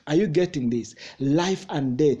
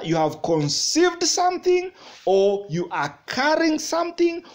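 raised, insistent preacher's voice; urgent pulpit delivery, pitched up; fervent preaching; 5 semitones higher, 3 dB louder than usual. Real, not acted. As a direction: hammer each phrase home to the congregation. Preaching at full force.

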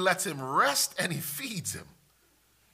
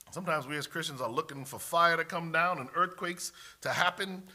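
ordinary talk, nothing marked

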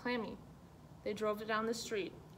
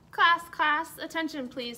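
calling for attention; 6 semitones higher, 8 dB louder than usual. Acted. Principal calls the class to order.

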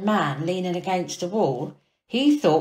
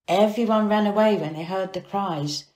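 ordinary delivery

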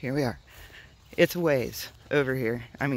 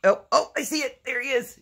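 excited; 10 semitones higher, 3 dB louder than usual. Real, not acted. Spotting the hidden quail.

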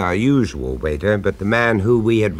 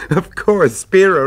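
bursting into a laugh, pitched up; 10 semitones higher, 5 dB louder than usual. Acted. Narrator laughing at his own realization. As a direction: laugh it out as the penny drops. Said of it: "I laughed out loud".

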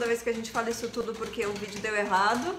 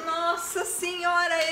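surprised; 6 semitones higher, much slower than usual. Real, not acted. Popcorn spilled on floor.

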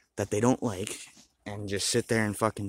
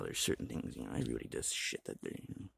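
hushed aside; whisper; 10 semitones lower, 10 dB quieter than usual. Real, not acted. Confiding to viewers in a hushed whisper. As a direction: drop to an unvoiced whisper.

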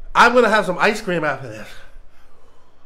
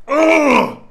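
pained groan; 7 semitones higher, 5 dB louder than usual. Real, not acted. Suffering hot pepper burn.

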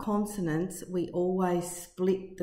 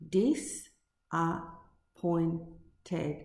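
very slow, every syllable pulled apart; Disappointed as dis-ap-point-ed, drawn out slowly; 3 dB quieter, much slower than usual. Shown as a demonstration. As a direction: stretch Disappointed into its four beats. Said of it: "See how I'm saying the word slowly".

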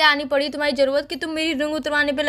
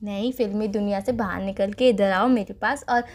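low, slow filmy romantic line; dramatic punchy hero lines; 9 semitones lower, 5 dB quieter than usual. Acted. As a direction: croon it like a film heroine. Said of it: "एक और फिल्मी दुनिया देखो". (धीमा, गहरा फ़िल्मी romantic dialogue)

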